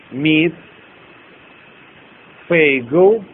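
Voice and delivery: deliberate single words with long gaps; slow dictation. Shown as a demonstration. Teacher dictating the last line, slow word-by-word dictation. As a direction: leave time to write after each word. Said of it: "я медленно продиктую".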